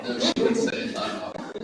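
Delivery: laughing. Real, not acted. Laughing joke reply.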